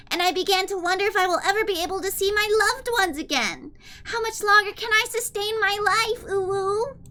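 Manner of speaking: put-on high cutesy anime-girl read; super kawaii desu voice. Streamer reading a villain's note to chat in kawaii voice. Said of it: "a super kawaii desu voice".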